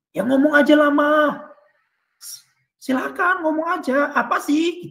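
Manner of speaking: a husband losing patience, out loud; fed-up coaxing of a stalling wife; impatient husband. Acted. Husband pressing wife again.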